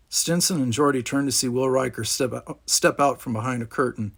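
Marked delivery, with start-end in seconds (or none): none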